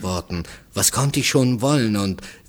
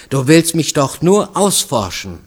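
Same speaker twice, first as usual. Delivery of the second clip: raised, accusing outburst; vehement; 6 semitones higher, 6 dB louder than usual. A narrator's reading. Woman accusing the stranger of prying, vehement. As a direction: accuse him heatedly, high and loud. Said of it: "sagte sie heftig".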